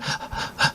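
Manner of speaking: sharp gasp for air; breathless gasp. Shown as a demonstration. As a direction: gasp like the bass knocked the wind out. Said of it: "kinda trying to catch my breath".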